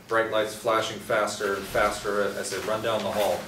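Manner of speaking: normal talking voice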